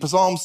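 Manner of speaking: "Psalms" misread as "puh-SAHMS"; sounded P. Acted. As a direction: sound the P in "Psalms", as a newcomer would. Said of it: "it looks like Psalm".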